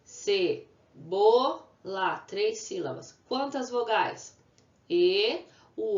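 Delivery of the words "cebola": first syllable alone, then slow ce, bo, la; teacher counting the beats of "cebola". Shown as a demonstration. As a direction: sound out "cebola" with gaps between syllables. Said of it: "Quantas sílabas?".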